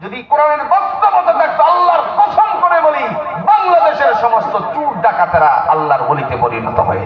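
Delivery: very high, strained-pitch oath, falling at the end; fervent preaching. Acted. Preacher swearing Quran would reform thieves, fervent high preaching. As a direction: swear it high to the crowd, then come down.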